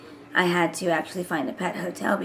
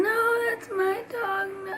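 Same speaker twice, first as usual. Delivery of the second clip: imitating crying child; 13 semitones higher than usual. Mom imitating her little son crying over the dog, high wailing. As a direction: wail it like a little kid refusing. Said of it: "like, crying".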